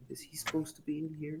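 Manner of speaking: hushed, conspiratorial aside through a laugh; mock whisper. Passenger discreetly asking the flight attendant, mock whisper. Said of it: "whisper, whisper to the".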